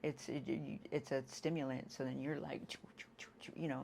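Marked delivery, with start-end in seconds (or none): 2.7-3.5 choo choo. Retold or imitated. Imitating the stimulant rush.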